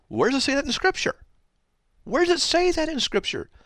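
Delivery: high, pressing question flung at critics; exasperated preacher challenging his opponents; incredulous challenge. Real, not acted. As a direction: demand chapter and verse, voice climbing. Challenge repeated, much higher.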